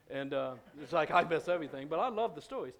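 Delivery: excited. Real, not acted. Pastor gushing about God's stories.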